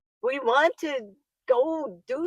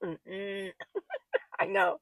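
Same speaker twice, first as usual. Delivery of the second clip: ordinary talk, nothing marked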